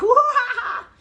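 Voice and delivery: high loud cackling monkey laugh; mocking monkey laugh. Inner monkey laughing at the workout-obsessed speaker, high cackle. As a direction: laugh at her like a cheeky monkey. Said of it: "laughing at me".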